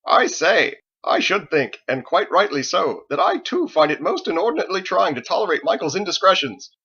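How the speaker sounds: high, loud, over-enunciated parody of Thomas; mocking pompous imitation. A narrator's reading. Harold parodying Thomas to tease Michael, loud hammed-up pomp. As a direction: ham up the fussy cleric to tease.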